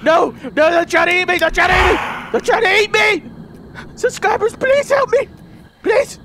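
high frantic shouting; panicked cries for help. Real, not acted. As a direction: cry out for help as if being eaten. Being attacked, begging subscribers.

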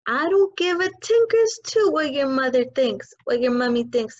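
yelled. Acted. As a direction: roar it at the girl. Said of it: "the Trunchbull yelled".

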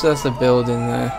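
normal talking voice

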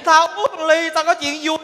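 usual speaking voice

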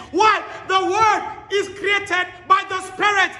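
fiery sermon delivery; full-force preaching. Acted. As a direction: preach it to the back row. Preacher exhorting the congregation.

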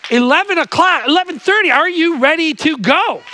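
high, loud hype-up shout; rousing call to crowd. Real, not acted. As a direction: whip up a sleepy congregation, loudly. Rousing the congregation.

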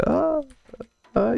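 high mock scream of fright; distress cry demo. Shown as a demonstration. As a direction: squeal like you're terrified. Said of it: "C'est quand j'ai super peur. Je fais".

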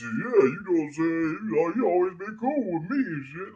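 a hint of fake friendliness; sucking-up voice. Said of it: "I said".

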